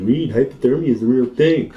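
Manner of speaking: lightly hammed-up motherly caution; imitating mom. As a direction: do a worried mom's voice in your head. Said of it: "I hear my mom's voice".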